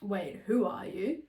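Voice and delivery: slow, pointed, bitchy question; mock-bitchy challenge. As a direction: draw it out with sudden attitude. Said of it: "just bitchy, just went".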